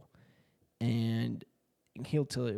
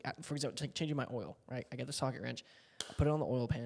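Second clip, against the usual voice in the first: ordinary delivery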